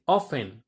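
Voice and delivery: "often" with the T left silent. Shown as a demonstration. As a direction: say "often" as OFF-en, no T sound. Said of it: "kind of pronunciation".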